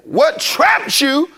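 slow, emphatic preacher's build; preaching. Acted. Preacher pressing the congregation, emphatic and slow. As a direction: press each phrase on the congregation.

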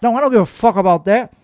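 ordinary talk

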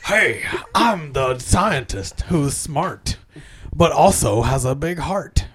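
slow, soft, low scientist voice; a hushed earnest nerd. Retold or imitated. Doing the Stargate cast, the scientist, slow and soft. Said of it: "The cast of Stargate Universe".

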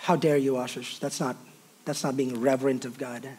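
hushed how dare you, playing a prim critic; a hint of a scandalized elder; mock scolding churchgoer. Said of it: "imagined scolding listener".

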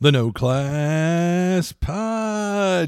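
slow drawn-out show title; announcer voice. Acted. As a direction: announce the show name slowly. Podcast intro title.

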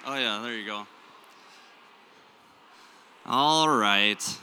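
usual speaking voice

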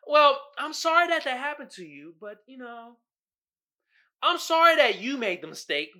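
high, polite apologetic put-on voice; customer service voice. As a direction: sound overly sorry to a customer. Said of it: "You always gotta be".

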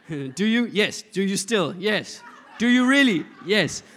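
loud mock vow exchange; insistent questioning. Acts out repeated wedding vows.

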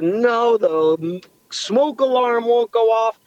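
high, drawn-out mock tearful plea; retold crying. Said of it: "call my fiance crying".

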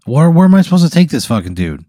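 put-upon player complaint; exasperated. Pretend. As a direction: demand it, fed up with the task. Player balks at carrying the character.

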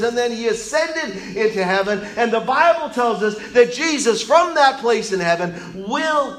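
loud, high preaching voice; full-force preaching. Acted. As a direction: proclaim it to the whole church.

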